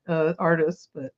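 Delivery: ordinary talk, nothing marked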